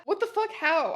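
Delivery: high-pitched, mild mock yell; mock scream. A narrator's reading. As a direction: blurt it in hammed-up disbelief. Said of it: "I screamed".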